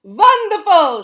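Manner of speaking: loud, high, slow delight; exclaimed. A narrator's reading. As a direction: gush it out grandly. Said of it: "she exclaimed".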